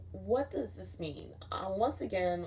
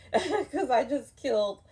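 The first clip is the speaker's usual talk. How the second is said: laughing it out, higher and louder; 7 semitones higher, 6 dB louder than usual. Real, not acted. Telling friends about the fight, laughing. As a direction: laugh through the words.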